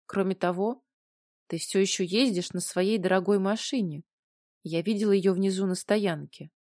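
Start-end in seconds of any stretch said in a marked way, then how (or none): none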